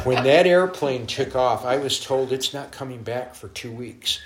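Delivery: ordinary talk, nothing marked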